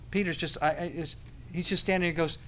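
ordinary delivery

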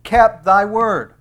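slow down and press on kept thy word; stress on kept thy word. Shown as a demonstration. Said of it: "watch the phrase".

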